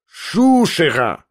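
shouted in fury. A narrator's reading. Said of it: "прокричал он вновь и почти скрежетнул зубами".

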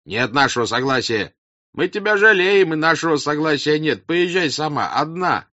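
high, loud peasant protest; crowd outcry. Acted. Peasants send the princess off alone, loud protest. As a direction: shout refusal from the thick of a crowd. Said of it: "раздалось в толпе с разных сторон".

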